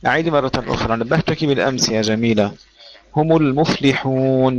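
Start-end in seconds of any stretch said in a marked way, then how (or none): 3.2-4.6 المفلحون with a clear short i, mufliħun; slow model of هم المفلحون; correct مفلحون. Shown as a demonstration. Teacher corrects Jamila's مفلحون, slowly. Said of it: "لا نقول مفلحون".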